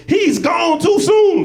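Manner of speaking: high, drawn-out mourner's shout; mock funeral wail. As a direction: wail it slowly in hammed-up grief. Mimics overwrought mourner at funeral.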